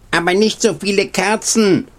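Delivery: owl's wail, pitched well up; lightly hooting owl. A narrator's reading. Owl objecting to too many candles, lightly wailing. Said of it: "heulte die Eule".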